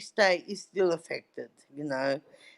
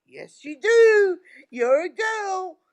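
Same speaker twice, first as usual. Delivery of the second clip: high, loud, slow mock insistence; mum's teasing insistence; 9 semitones higher, 6 dB louder, slower than usual. Mum teasing her daughter, high, loud and slow. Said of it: "She goes".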